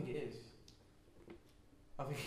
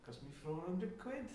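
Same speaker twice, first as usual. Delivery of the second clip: soft Welsh lilt, rising at the end; Welsh accent; 3 semitones higher, 6 dB quieter than usual. Acted. Moaning to co-hosts about cost, soft Welsh accent. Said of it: "Welsh accent".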